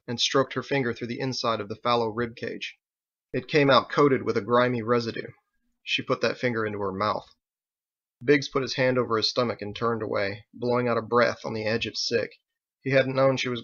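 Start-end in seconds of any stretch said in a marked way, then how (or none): none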